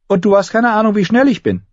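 put-on high-pitched, defiant young girl; girl boasting back. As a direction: top his brag in a high girl's voice. Arya's retort in girl's voice.

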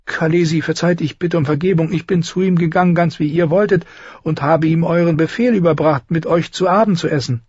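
high-pitched anxious servant; terrified handmaid explaining herself; frightened pleading. A narrator's reading. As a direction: beg forgiveness in a high, fearful voice. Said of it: "zitterte vor Angst".

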